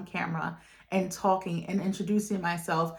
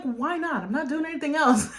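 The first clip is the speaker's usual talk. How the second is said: laughing aside; 6 semitones higher than usual. Retold or imitated. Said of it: "I'm like".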